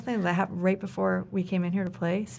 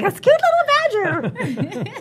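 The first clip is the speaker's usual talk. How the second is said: cutesy voice; 12 semitones higher, 5 dB louder, slower than usual. Retold or imitated. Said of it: "I'm just like".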